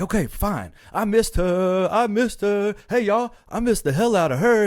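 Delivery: loud, high confession; acted outburst. Will admitting in an argument he missed her, loud outburst. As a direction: blurt it out for everyone to hear.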